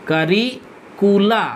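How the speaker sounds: Curricula stretched, each syllable long; drawn out slowly. Shown as a demonstration. Teacher repeating the plural very slowly for students. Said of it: "curriculum থেকে curricula".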